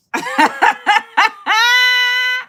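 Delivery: high, loud burst of laughter; loud shrieking laugh. Real, not acted. Reacting to the Lenox line, loud shrieking laugh. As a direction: crack up out loud.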